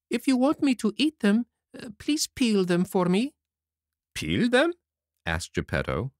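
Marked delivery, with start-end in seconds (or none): 0.1-3.2 very high-pitched little puppet voice; puppet Pinocchio. Acted. Puppet asks Geppetto to peel the pears, high childish voice. 4.2-4.7 very surprised. Acted. Geppetto hears the puppet wants pears peeled, astonished high query. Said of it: "very much surprised".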